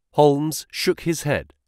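ordinary delivery

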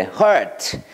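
hurt said like Korean 그녀 her plus a final t; slow teacher model of hurt for learners; English hurt. Shown as a demonstration. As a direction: clear demo of hurt, ending on a light t sound. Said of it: "트 붙이면 돼".

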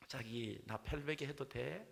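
slow Seoul bride with a rising lilt; Seoul accent bride. Acted. Seoul bride asks for an arm pillow, coy Seoul accent. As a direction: coax in drawn-out Seoul speech. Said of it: "서울 신부가 말합니다".

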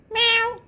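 high, loud mock meow; cat meow. Owner meows like a cat to tease her dog. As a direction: squeak out a cat's meow.

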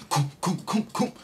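pounding heartbeat mimicked with the voice; heartbeat sounds. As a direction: thump out a heartbeat. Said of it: "my heart is beating".

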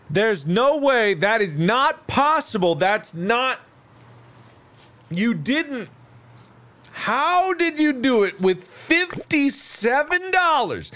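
high-pitched incredulous protest building to a screamed "No!"; outraged disbelief. Pretend. Player refusing to believe the fifty-seven dollar bridge, outraged disbelief.

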